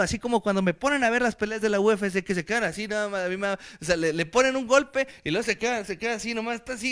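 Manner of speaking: usual speaking voice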